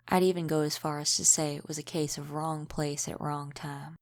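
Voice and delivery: normal talking voice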